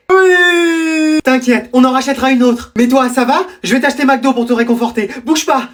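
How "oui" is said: long high whining wail; hammed-up childish bawling; fake tearful wail. Fake tears confessing to mother.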